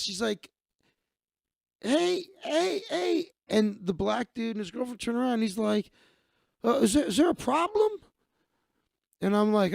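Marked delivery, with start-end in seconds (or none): 1.8-3.3 high-pitched, insistent calling imitation. Woman hails a man ahead in line, high calling out. 6.6-7.9 higher-pitched, halting question; wary challenge. Retold or imitated. Man turns round to the woman waving, wary questioning. Imitates man reacting to being hailed.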